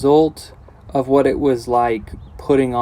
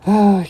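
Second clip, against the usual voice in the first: a big loud sighing "ah", pitched up high; loud exasperated sigh; 9 semitones higher, 7 dB louder than usual. Real, not acted. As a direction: let out a frustrated sigh on "ah". Exasperated sigh about fixing.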